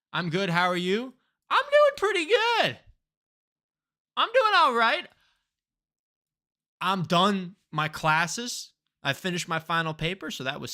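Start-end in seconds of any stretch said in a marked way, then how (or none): 1.5-5.1 loud, very high squeaky-pitched reply; exaggerated high-pitched cheeriness; playful high voice. Playful reply to chat greeting.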